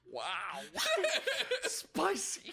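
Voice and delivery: squeaky giggle through the word; laughing. Real, not acted. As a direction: laugh it out, high. Laughing at the rant.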